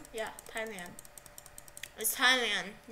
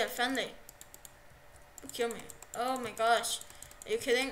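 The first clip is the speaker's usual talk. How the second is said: ordinary talk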